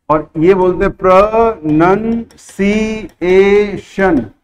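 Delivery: "प्रोनंसिएशन" slowly, syllable by syllable; careful pronunciation. Shown as a demonstration. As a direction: model it slowly for them to repeat. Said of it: "अब बोलो इसको". ("प्रोनंसिएशन" धीमे साफ़ उच्चारण)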